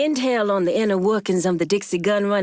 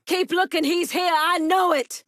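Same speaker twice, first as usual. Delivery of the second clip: urgent calling out; 9 semitones higher than usual. Acted. Urgent search, calling to others.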